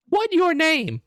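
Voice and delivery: very high, loud squeaky orc demand; orc guard. Acted. Orc guard demands the disguised fighter's name, high squeaky voice. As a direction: bark the question as a dim orc. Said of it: "this orc looks at you and says".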